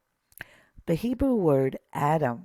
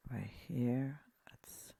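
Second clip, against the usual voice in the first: quick whispered pointer, barely voiced; whispered aside; 10 dB quieter, slower than usual. Real, not acted. Teacher whispering to himself over the Hebrew text, softly under breath. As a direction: breathe it as a private note.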